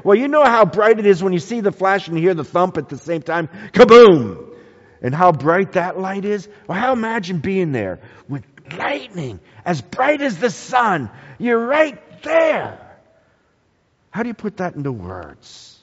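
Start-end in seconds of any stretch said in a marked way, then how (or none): 3.7-4.5 a big loud kaboom like a thunderclap; booming mock thunder. Imitating thunder clap. 11.4-12.8 excited exclaim. Real, not acted. Preacher exclaims closeness to lightning.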